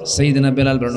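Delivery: usual speaking voice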